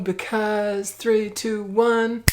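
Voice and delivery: slow building countdown, rising high and loud; dramatic countdown. Acted. Vlogger counting down to a magic jump cut.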